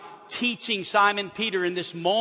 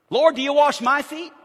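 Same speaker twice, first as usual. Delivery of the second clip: indignant Peter; 8 semitones higher, 10 dB louder than usual. Retold or imitated.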